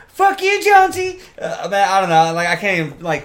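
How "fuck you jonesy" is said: very high, loud character squeal; Shorzy. Retold or imitated. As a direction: chirp a rival in a put-on high voice. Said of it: "he's like".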